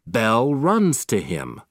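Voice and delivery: ordinary delivery, nothing marked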